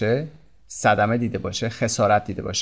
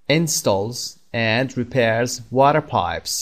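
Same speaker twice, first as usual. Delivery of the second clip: slow model reading; much slower than usual. Shown as a demonstration. Teacher reads the definition to learners, very slow and careful. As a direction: read "Builds or repairs homes" very slowly, word by word. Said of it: "teacher repeats definition sentence".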